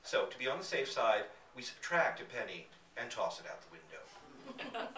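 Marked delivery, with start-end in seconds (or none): none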